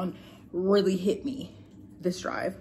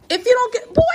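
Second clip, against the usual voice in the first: high-pitched exasperated warning; sharp parental warning, pitched way up; sudden scolding; 5 dB louder than usual. Real, not acted. Breaks off to scold the child.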